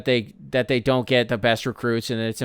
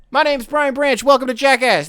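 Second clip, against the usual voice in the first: Jackass intro parody; 10 semitones higher, 3 dB louder than usual. Pretend.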